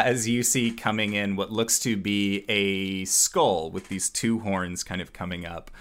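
normal talking voice

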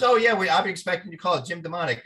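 lightly voiced eager agent; agent on the phone. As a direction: play the slick agent, quick and keen. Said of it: "he goes".

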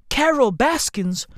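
ordinary talk

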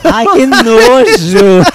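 loud, high, dragged-out cry of disgust; drawn-out loud yuck. Real, not acted. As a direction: recoil at something gross, slow and loud. Disgusted reaction to snot story.

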